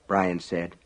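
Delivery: usual speaking voice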